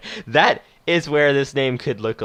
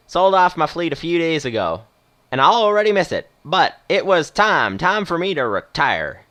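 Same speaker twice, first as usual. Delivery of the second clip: put-on Western accent; 5 semitones higher, 4 dB louder than usual. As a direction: stretch it out as a retired Western movie captain. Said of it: "doing a sort of Western accent".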